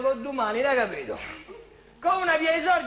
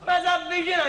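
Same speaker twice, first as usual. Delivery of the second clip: usual speaking voice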